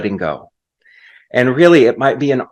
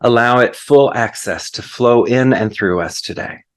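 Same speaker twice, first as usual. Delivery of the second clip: prayer; 3 semitones lower than usual. Acted. Preacher leads the congregation into thanks, prayerful and hushed at the end. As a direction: settle gently into the amen.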